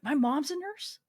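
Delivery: usual speaking voice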